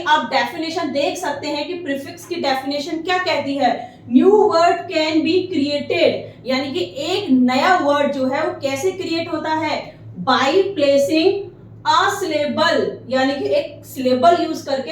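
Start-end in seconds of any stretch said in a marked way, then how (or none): 4.1-6.1 very slow, word by word; slow dictation. Shown as a demonstration. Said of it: "dictating a definition slowly". 10.2-13.0 very slow, word by word; slow dictation. Shown as a demonstration. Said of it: "dictating a definition slowly".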